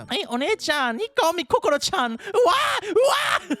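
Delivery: squealing little sister, very high; high-pitched anime squeal building to loud wails; Kokoro. Acted. Little sister telling One-chan about Makoto, high squeals turning loud.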